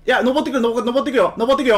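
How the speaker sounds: loud, high urgent warning; urgent callout. Real, not acted. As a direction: shout the warning to teammates. Warning teammate enemy climbing.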